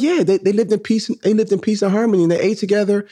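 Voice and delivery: put-on very high-pitched child voice; excited little girl. Daughter insisting to dad on what teacher taught, excited. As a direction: imitate a third grader eagerly explaining. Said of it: "So she was excited".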